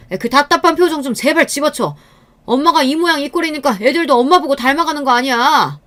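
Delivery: high-pitched, irritated scolding; acted angry rant, voice pushed up; berating husband. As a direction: lash out at your wife in exasperation. Husband berates his wife.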